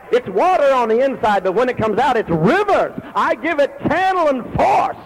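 high, loud fervent preaching; preaching at full force. Real, not acted. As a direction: pour it out like a river in full spate.

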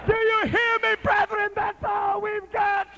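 ordinary delivery

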